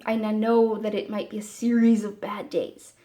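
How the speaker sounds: ordinary delivery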